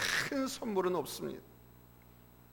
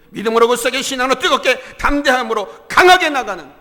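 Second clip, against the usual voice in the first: loud, raised, run-on preacher's chant; rallying cry; 6 semitones higher, 13 dB louder than usual. Real, not acted. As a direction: fire up the congregation without pausing. Slogan repeated as a rallying cry. (쉼 없이 힘차게 외치는 선포)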